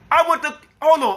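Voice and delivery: ordinary talk, nothing marked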